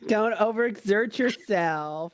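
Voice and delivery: high, slow sing-song nurse; mock nurse. Friend playing the nurse teasing the couple. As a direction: tease like a sweet nurse.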